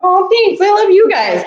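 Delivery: touched and holding back tears; moved. Real, not acted. Streamer thanking her friends, moved, nearly crying. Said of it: "I'm not gonna cry".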